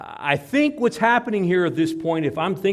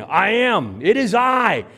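raised, high, emphatic declaration; loud proclamation; 7 semitones higher, 9 dB louder, slower than usual. Shown as a demonstration. Preacher proclaiming the divine answer, loud and emphatic. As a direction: proclaim it forcefully.

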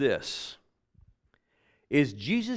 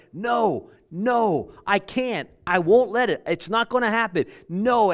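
high, louder emphatic protest; emphatic refusal; 7 semitones higher, 6 dB louder than usual. Retold or imitated. John protesting to Jesus that it can't happen, emphatic. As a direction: refuse firmly, punching each short phrase. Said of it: "he's saying Jesus in an emphatic way".

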